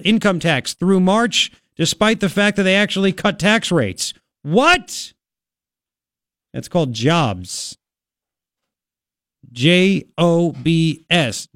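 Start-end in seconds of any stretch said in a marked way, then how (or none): 4.4-5.1 high, rising astonished "what". Real, not acted. Host astonished at record tax collections, incredulous. 9.5-11.5 very slow, letter by letter; emphatic spelling. Real, not acted. Host spelling out jobs for listeners, slow and emphatic.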